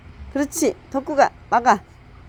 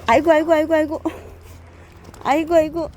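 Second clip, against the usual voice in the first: high, lively aigo over the puppy; high fussing; 5 semitones higher, faster than usual. Real, not acted. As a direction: fuss over the puppy playfully. Fussing over the puppy's rough play.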